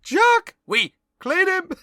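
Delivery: loud, high cartoon French accent. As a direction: squeal it like the excitable French shrimp. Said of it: "the French accent is so disgustingly stereotyped".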